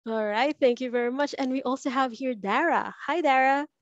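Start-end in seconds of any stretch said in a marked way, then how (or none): none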